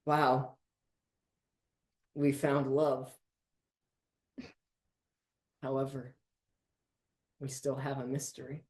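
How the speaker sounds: low, soft deadpan group voice; a hint of solemn, hushed declaration; lightly voiced gang. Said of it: "And they go".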